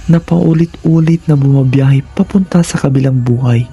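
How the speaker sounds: usual speaking voice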